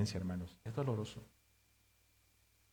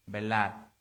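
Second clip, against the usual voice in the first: ordinary talk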